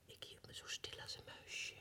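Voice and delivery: hushed whisper, hiding. Acted. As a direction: whisper like a frightened mouse. (zacht gefluisterd)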